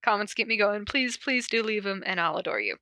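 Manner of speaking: ordinary delivery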